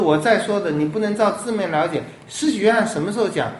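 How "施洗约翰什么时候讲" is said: usual speaking voice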